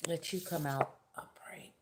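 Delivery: unvoiced whisper to herself. Real, not acted. Reader whispers to the cards, a private whisper. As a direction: breathe it out like asking the cards.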